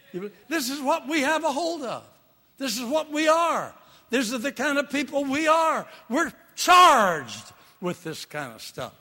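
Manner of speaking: high, loud preacher's proclamation; rally the congregation; preaching at full force. Acted.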